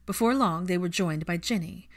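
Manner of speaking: usual speaking voice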